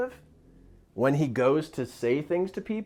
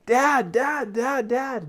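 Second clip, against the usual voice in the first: put-on high, urgent little boy calling Dad; excited son; 9 semitones higher, much slower than usual. Son running upstairs to tell his dad, excited. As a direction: imitate a kid rushing up, bursting to tell Dad. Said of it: "comes running upstairs".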